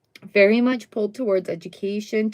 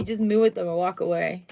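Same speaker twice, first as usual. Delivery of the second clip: ordinary delivery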